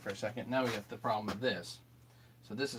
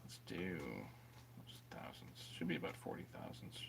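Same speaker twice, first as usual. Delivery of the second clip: muttering quietly; 19 dB quieter than usual. Real, not acted. Muttering measurements to himself.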